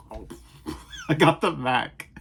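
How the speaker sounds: normal talking voice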